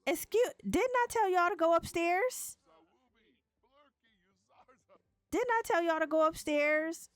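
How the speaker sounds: high, indignant scolding. Real, not acted. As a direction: scold them in mock outrage. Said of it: "scolding game characters".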